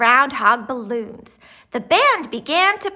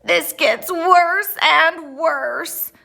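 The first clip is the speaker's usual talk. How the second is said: slow, louder moan of despair; 5 semitones higher, 4 dB louder, much slower than usual. A narrator's reading. Gregory despairs without glasses, moaning slowly. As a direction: drag it out in a whiny moan. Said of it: "moaned Gregory".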